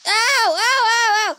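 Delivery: loud pained ows; getting a leg cramp. Real, not acted. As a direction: cry out in sudden pain. Hurt in the blast.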